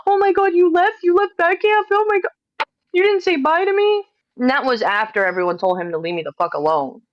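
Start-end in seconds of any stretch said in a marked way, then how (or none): 0.1-4.0 high-pitched panicked imitation; exaggerated panic, pitched way up; mock panic. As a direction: mimic someone frantic at being left behind. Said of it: "him panicking".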